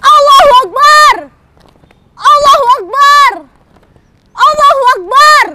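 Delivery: loud high chant; rallying cry. Acted. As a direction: shout it to the crowd. Youths cheer on hotel.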